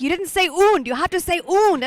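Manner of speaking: "und" said the German way, oo-nd. Retold or imitated. Directors correcting her German "und". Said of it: "they're like".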